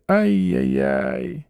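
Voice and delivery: long drawn-out regretful "ai-yai-yai"; rueful ai-yai-yai. A narrator's reading. Ostap regrets the girl he has no time for, ruefully. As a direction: sigh it out slowly, lamenting.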